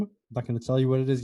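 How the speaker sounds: normal talking voice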